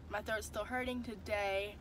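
ordinary talk, nothing marked

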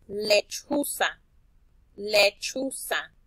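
"lechuza" drawn out slowly; slowed word. Shown as a demonstration. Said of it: "la otra, que era de". ("lechuza" pronunciada muy despacio)

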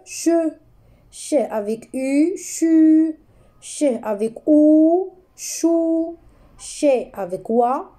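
teacher calling out syllables; syllable reading. Shown as a demonstration. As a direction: pupils follow the syllables. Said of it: "ch avec A".

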